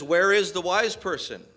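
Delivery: sarcastic. Retold or imitated. Paul mocking the wise men of Corinth, loud sarcastic. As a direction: taunt the so-called wise. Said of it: "the sarcasm in Paul's voice here".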